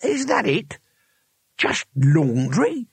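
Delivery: high, drawn-out incredulous outrage; shaking with rage. A narrator's reading. Cohen outraged at the luggage's contents, raging. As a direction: stretch it out in indignant disbelief, rising. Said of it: "He was shaking with rage".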